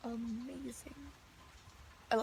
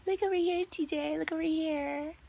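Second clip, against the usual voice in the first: higher, louder mock coaxing of a toddler; mock squealing; 4 semitones higher, 5 dB louder than usual. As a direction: imitate the boss squealing at a kid. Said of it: "squealing at the kid".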